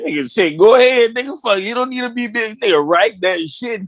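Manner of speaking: high-pitched, animated egging-on; excited urging. Real, not acted. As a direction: urge a friend on, voice riding up high. Urging the rapper to write a book.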